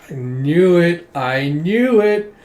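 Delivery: high, loud, drawn-out gloating; rising, gleeful told-you-so; triumphant outburst. Real, not acted. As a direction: stretch it out in real vindication. Reader reacts to confirmed theory.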